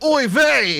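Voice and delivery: loud, high mock oy vey; Yiddish exclamation. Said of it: "and he's like".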